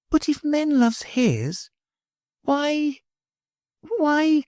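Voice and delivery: put-on a child near tears asking why; a touch of trembling sorrow; voice quivering. A narrator's reading. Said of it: "her voice quivered".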